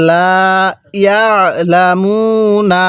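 লা ইয়ালামুনা with long vowels, throat-squeezed ain; slow model recitation. Shown as a demonstration. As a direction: press lightly in the throat on ইয়ালামুনা. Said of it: "গলার মধ্যে হালকা চাপ দিয়ে পড়বেন".

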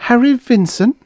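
high, loud insistent question; persistent. A narrator's reading. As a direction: press him in a high-pitched voice. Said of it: "she persisted".

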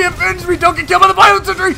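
ordinary delivery

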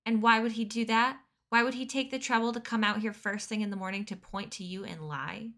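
loud angry questioning; angry demand. Acted. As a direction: press him furiously. Chugger angrily questions Dune.

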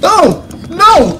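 high, frantic cries of no; panicked shout. Real, not acted. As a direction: yell in panic as she closes in. Player about to be caught.

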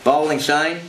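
Aussie wicketkeeper's encouraging shout, mildly done; Australian accent. Retold or imitated. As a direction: call it out in broad Australian, ending upward. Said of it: "my little Aussie prep".

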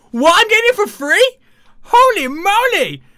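high, loud amazed exclamation; loud high delighted disbelief; thrilled surprise. Real, not acted. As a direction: gasp with glee at free room. Gets free room.

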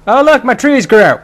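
high, loud burst of excitement; sudden loud, high-pitched exclamation; excited shout. Real, not acted. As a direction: call out a thrilling discovery at full volume. Excited discovery during gameplay.

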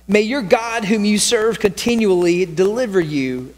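ordinary talk

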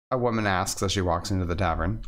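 ordinary talk

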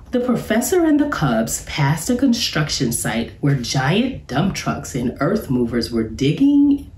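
usual speaking voice

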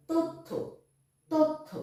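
তথ্য said as "tottho", with a doubled th; high, careful তথ্য with the th held double; clear pronunciation demo. Shown as a demonstration. Teacher demoing the word to pupils, careful and clear. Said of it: "থয় জফলা".

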